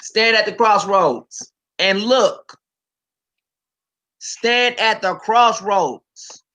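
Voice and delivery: preacher reading scripture aloud with weight; dramatic scripture reading. Acted. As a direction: declaim it like a prophet.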